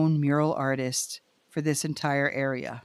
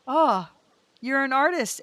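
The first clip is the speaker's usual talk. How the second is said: awed; 8 semitones higher, 3 dB louder than usual. Retold or imitated. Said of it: "And I'm like".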